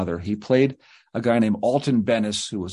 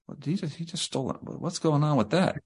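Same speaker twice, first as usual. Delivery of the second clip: much softer, fast stunned murmur; hushed disbelief; 3 semitones higher, 6 dB quieter than usual. Retold or imitated. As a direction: trade a quick shocked murmur under your breath. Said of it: "they're like".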